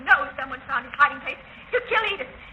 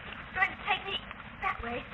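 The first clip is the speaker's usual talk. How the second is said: ordinary delivery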